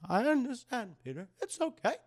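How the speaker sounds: high soothing aunt voice; Aunt May voice. Retold or imitated. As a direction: coo it like a kind old aunt. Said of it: "That's my Aunt May voice".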